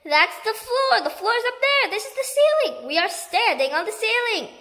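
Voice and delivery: high, loud panicked cries; frantic terrified shouting. Acted. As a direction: shriek in rising panic. Panicked wife discovers upside-down room.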